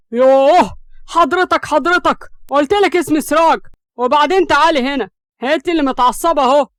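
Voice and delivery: loud, high-pitched exasperated outburst. A narrator's reading. Siraj scolds Hams for calling him sir, exasperated. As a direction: throw her formal word back in anger. Acted quarrel, exasperated at her formality.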